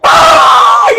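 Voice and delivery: grito de llanto agudo y fuerte; lamento agudo. Retold or imitated. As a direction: suelta un ay de llanto. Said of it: "Empezó a llorar". (lamento de llanto agudo)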